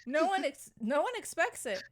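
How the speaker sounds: high, loud and eager; excitedly raving about a favorite movie scene. Real, not acted. Excited agreement over favorite scene.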